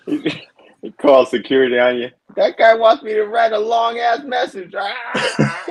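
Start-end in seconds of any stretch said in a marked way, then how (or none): 2.4-5.6 high, slow mock complaint; old man voice. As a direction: grumble high and slow, ending in a cry. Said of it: "old man voice".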